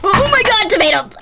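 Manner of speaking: real startled shout at a jump scare. Real, not acted. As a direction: jump out of your skin.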